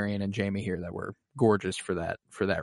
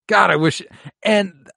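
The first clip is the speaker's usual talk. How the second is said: usual speaking voice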